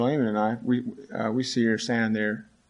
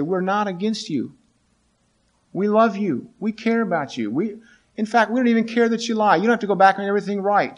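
pleading reassurance; 9 semitones higher, 3 dB louder than usual. Retold or imitated. Classmates reassure upset student.

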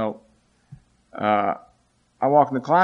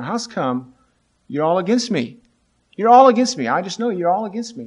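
rising, louder whine that all are against her; upset teen protest; 7 semitones higher, 6 dB louder than usual. Retold or imitated. A cornered student protesting to her classmates, rising to a near-yell.

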